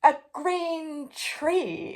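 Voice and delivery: slow, high, loud amazement; king amazed at the green tree; astonished exclamation. A narrator's reading. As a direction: draw it out in wonder. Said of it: "exclaimed the king".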